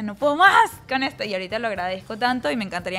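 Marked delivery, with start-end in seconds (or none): 0.0-1.2 high, loud mock exasperation; fed-up outburst. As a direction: wail it like a fed-up kid. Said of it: "Yo decía".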